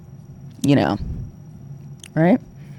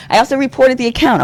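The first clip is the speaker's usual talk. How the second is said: repeated higher with laughing disbelief; incredulous higher emphasis after a laugh; emphatic higher repeat; 8 semitones higher, 4 dB louder than usual. Real, not acted.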